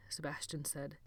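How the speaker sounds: usual speaking voice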